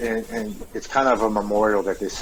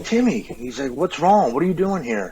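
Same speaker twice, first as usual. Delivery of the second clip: high, surprised call of his name; a touch of alarm in the old man's voice; father stunned to find Tim crying by the road; startled father; 6 semitones higher than usual. Retold or imitated. Father startled to see him.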